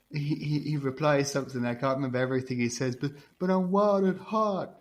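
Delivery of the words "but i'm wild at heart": high, slow Southern drawl; Southern accent. Acted. Sailor replies to the Good Witch, high Southern drawl. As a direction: impersonate a movie hero's stretched-out Southern drawl. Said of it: "and he says".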